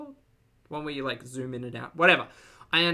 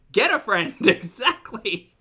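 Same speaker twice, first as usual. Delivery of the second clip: ordinary delivery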